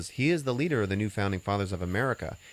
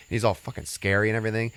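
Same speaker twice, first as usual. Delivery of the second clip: normal talking voice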